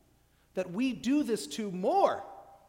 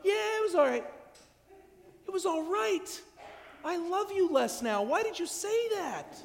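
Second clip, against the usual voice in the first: lukewarm friend; 11 semitones higher, 3 dB louder than usual. Retold or imitated. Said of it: "they'll go".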